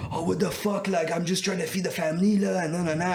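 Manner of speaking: put-on high, indignant French-accented protest; pissed French guy. Accused seller protests to the buyer, kinda pissed, in French accent. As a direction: mimic a rattled seller protesting his innocence. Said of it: "He was, like, kinda pissed".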